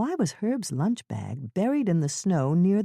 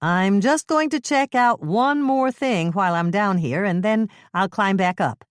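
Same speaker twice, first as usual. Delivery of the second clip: louder, steady voice calling up; calling up steadily; 6 semitones higher, 6 dB louder than usual. Acted. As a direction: call up from the ditch, steady. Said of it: "how strong and steady her voice was".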